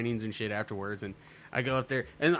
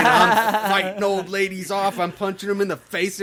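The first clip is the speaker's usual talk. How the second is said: loud; 7 semitones higher, 9 dB louder than usual. Retold or imitated. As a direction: belt it out like a wild brawl. Retold wild fight.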